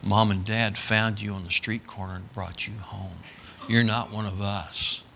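low, soft, grave delivery; solemn teasing lie. Acted. Big brother telling little brother he is not family, solemn.

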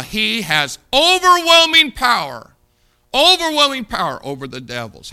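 fiery preacher's cry; preaching at full force. Acted. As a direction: ring it out over the congregation. Preaching climax.

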